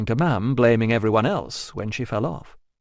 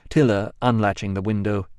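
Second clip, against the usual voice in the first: ordinary delivery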